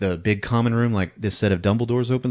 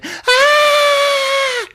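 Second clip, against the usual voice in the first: very high, loud imitated scream; piercing mock scream; 8 dB louder than usual. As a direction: let out a long horror-movie shriek. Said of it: "And she's screaming".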